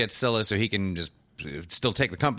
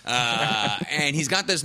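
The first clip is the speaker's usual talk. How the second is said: usual speaking voice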